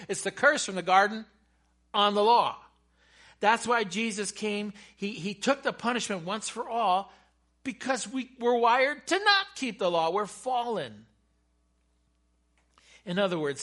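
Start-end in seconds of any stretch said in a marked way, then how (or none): 0.1-11.1 loud, raised pulpit voice; excited preaching. Real, not acted. Preacher explaining the curse of the law, loud and excited. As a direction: drive the point home with excitement. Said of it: "I'm getting excited about this".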